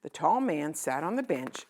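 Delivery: plain reading voice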